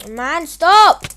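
sudden frantic shout; real yell. Real, not acted. Player yelling at the attacker chasing him, frantic shout. Chased by another player in PvP.

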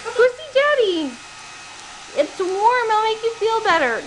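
high cooing to the dog; coaxing the wet puppy toward dad. Real, not acted. Cooing to the puppy after bath.

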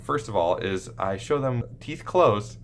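normal talking voice

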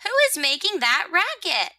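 high-pitched complaint; indignant rosebud. Acted. Rosebud to whoever makes the racket, indignant.

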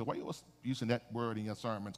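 low, soft, hurried aside; muttered objection. Retold or imitated. Preacher imitating people who question his wording, muttered objection. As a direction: toss off the critics' question under your breath.

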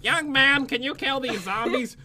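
teasing old-lady impression; mocking grandma voice. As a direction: ask as a doddering grandma. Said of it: "That's what she".

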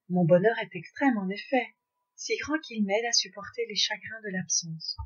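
ordinary delivery, nothing marked

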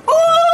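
sudden high, loud startled "oh"; aunt startled by the boss walking in, yelping. Acted. Startled yelp.

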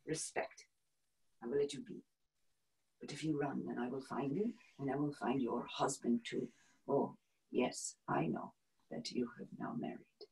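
very soft, restrained menace; hushed threat. Acted. Nazi officer threatening her and her husband, hushed menace. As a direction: drop to a low-volume, deliberate warning.

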